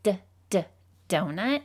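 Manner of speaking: d, d, then the whole word donut; teacher sounding out donut. Shown as a demonstration. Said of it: "If I were to say the word donut".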